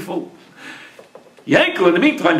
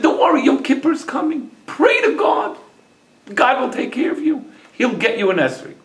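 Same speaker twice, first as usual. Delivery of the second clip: higher-pitched voice of a comforting wife; wife's reassurance; 7 semitones higher than usual. A narrator's reading. Wife tells her depressed husband not to worry, high-pitched reassurance.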